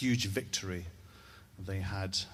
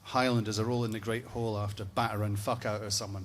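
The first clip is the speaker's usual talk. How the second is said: usual speaking voice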